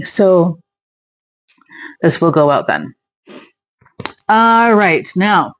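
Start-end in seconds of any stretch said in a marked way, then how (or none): none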